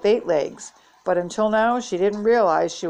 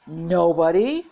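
incredulous question with a rising end; mildly roared disbelief, voice swinging up; near roar; 3 dB louder, slower than usual. A narrator's reading. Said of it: "Olivia nearly roared".

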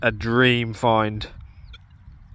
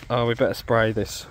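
ordinary talk

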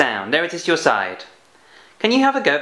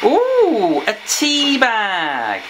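Geraldine's voice; 5 semitones higher, much slower than usual. Acted. Puppet Geraldine answers the teacher.

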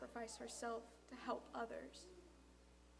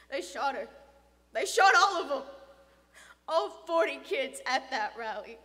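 loud, hard-hit, building; bitter outburst; 2 semitones higher, 17 dB louder than usual. Acted. Father telling boy of the shootings, anguished. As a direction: pound out each terrible fact.

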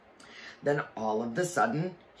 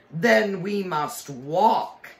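high, loud, slow witch command; cold commanding witch; 7 semitones higher, 7 dB louder, slower than usual. A narrator's reading. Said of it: "said the witch".

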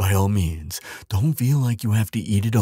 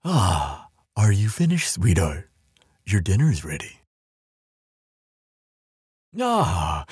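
normal talking voice

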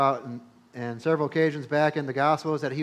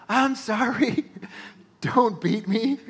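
much higher mock pleading child voice; fake crying kid; 8 semitones higher, 3 dB louder, slower than usual. Boy faking a whipping for his mom, mock pleading. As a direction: pretend to beg while faking a beating. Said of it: "I'd be like".

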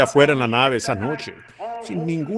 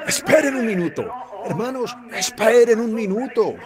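higher, laughing excited call; excited shouting; 7 semitones higher than usual. Retold or imitated. Paul calling the brothers to wait, high laughing excitement. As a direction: call out with a laugh, almost giddy.

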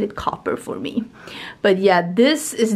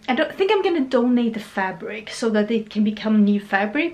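ordinary talk

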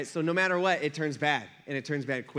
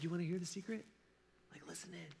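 soft, voice dropped to a secret whisper; hushed secret; 12 dB quieter, faster than usual. Acted. Preacher to church, whispering a secret as if confiding. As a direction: lean in and let them in on a secret.

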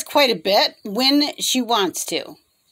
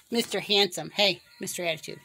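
ordinary delivery

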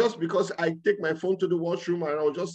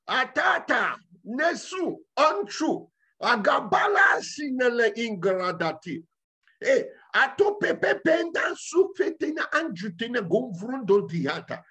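high shouted heavenly tongues; God speaking in tongues; 8 semitones higher than usual. Shown as a demonstration. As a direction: voice God's own language with fervour. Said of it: "What did he say?".

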